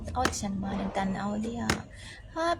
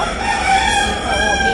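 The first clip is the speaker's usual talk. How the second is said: high, loud, slow overacted denial; mock dramatic denial; 10 dB louder, slower than usual. Insisting to viewers she's not overacting, mock dramatic and high. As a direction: ham up the denial.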